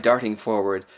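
usual speaking voice

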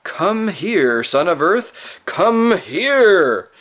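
high, loud lion's command; commanding call that ends roaring; building to a roar. A narrator's reading. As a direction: summon him, swelling into a roar. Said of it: "last word was no longer the hint of roar, but almost the real thing".

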